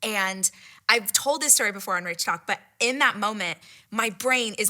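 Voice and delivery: ordinary delivery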